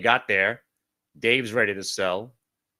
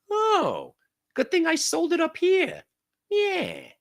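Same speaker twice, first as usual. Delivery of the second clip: much higher mock voice of a smug trader; slower than usual. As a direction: play the trader patting himself on the back, way up high. Imitating a relieved trader.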